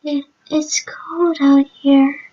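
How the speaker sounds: breathless, voiceless whisper. A narrator's reading. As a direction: barely breathe the words out. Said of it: "mouthed those four words".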